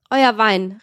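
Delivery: loud, scornful call. A narrator's reading. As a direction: shout it across the table. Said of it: "rief O'Malley".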